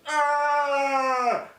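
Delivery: high, loud hammed-up yell; hammed-up movie scream; mock anguished scream. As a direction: blast out a fake cry of pain. Mimics hero scream.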